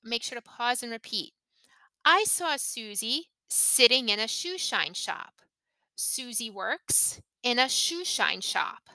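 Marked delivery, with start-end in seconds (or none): 2.0-8.9 measured phrases, pausing after "Susie" and "works"; careful intonation demo. Shown as a demonstration. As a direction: model it for learners phrase by phrase. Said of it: "listen to the intonation".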